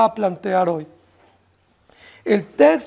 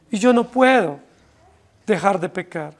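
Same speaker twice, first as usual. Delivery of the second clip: ordinary talk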